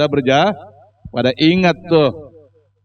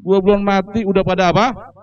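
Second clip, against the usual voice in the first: put-on higher, reproachful old man voice; complaining old man; 6 semitones higher than usual. Dead haji scolding forgetful children, higher reproachful voice. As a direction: reproach the children who forgot him. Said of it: "begitu, Pak".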